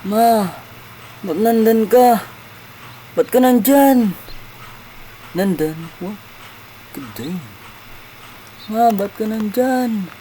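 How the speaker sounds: high calls for mother; voiced child looking for mother; child calling mom. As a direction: call out anxiously for Ma.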